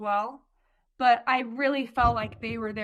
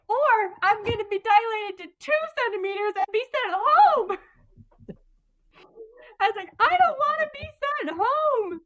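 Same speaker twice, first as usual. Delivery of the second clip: much higher, louder, mock-whining; mock whiny worry; 10 semitones higher, 5 dB louder than usual. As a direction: whine it like you dread being sent home. Retelling her own worried reply.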